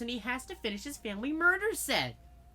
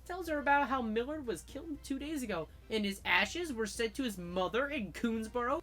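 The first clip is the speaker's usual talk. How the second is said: ordinary talk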